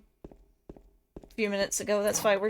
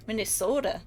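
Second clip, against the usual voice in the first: lightly mocking Minnesota accent on "Minnesota". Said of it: "They got weird accents out there".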